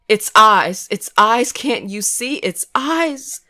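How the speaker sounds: wailing. A narrator's reading. Hysterical girl begging friends to see, wailing high. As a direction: shriek it out in terror. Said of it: "she wailed".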